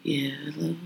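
very soft, low-pitched phone hello; talking mad low. Shown as a demonstration. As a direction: drop right down to a hushed hello. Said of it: "you gotta talk mad low".

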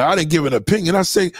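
usual speaking voice

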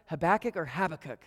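"Habakkuk or Habakkuk", stress on second syllable, then first; two rival pronunciations. Retold or imitated. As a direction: contrast ha-BAK-uk with HAB-a-kuk. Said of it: "Do you pronounce it".

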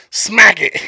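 loud push to smack it; urging shout. Retold or imitated. Telling the girl to smack the wasp, loud urging. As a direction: bark the order at her.

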